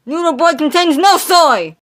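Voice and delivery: loud, bright advertising shout; mock commercial tagline; ad slogan. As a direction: sell it like a cereal ad.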